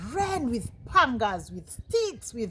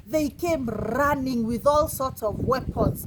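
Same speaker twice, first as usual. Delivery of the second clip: plain reading voice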